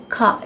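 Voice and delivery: "car" as KAA with no R; British pronunciation. Shown as a demonstration. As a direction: ignore the R in "car". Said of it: "The R is almost ignored".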